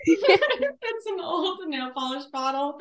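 laughing through the words. Real, not acted. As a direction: giggle it out. Laughing while talking.

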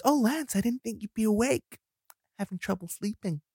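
high, soft, coy stepmother; stepmom voice. Acted. Stepmom meeting Lance in the hallway at night, softly high-pitched. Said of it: "And she goes".

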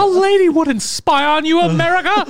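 hammed-up high pretend speech; mock Biden voice. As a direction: play the president pleading coyly to America. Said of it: "he's like, "I'm a lady".

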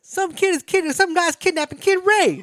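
high, loud mock alarm; hammed-up high-pitched outcry; alarmed shout. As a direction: yell it out high, as if raising the alarm. Acting out Joe Clark's alarmed reaction.

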